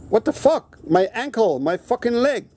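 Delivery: what-the-hell protest, voice jumping high; incredulous outburst. Retold or imitated. Baffled by the eye test.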